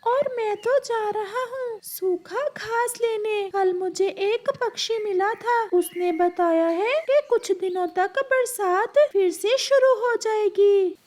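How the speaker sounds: high, eager parrot telling news of rain. Acted. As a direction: squeak it out like a busy little parrot. Parrot speaks, high put-on voice.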